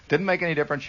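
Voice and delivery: usual speaking voice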